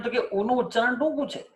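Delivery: ordinary delivery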